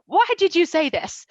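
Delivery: arguing with a friend over his post, playful indignation; mock scolding. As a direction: call him out on the phone. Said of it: "gotten in a couple arguments with him".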